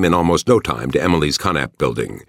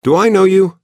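much higher, louder puzzled question; 7 dB louder than usual. A narrator's reading. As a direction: ask with baffled surprise.

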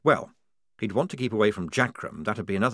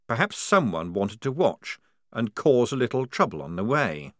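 ordinary delivery

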